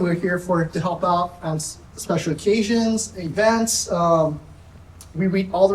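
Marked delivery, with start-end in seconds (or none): none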